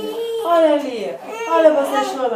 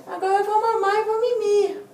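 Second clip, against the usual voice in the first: voicing baby; 9 semitones higher than usual. Mother voicing baby goodbye.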